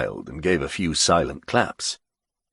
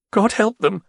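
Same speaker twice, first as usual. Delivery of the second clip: blurted; 13 semitones higher, 4 dB louder than usual. Acted. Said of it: "Ger blurted out".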